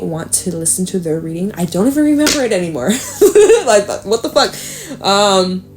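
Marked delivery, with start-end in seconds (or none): 3.0-3.6 high burst of laughter. Real, not acted. Laughs at forgetting the reading.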